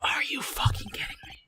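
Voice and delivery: breathy, voiceless disbelief; stunned whisper. Real, not acted. Gamer stunned by the twist, whispering in disbelief. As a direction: hiss it in disbelief.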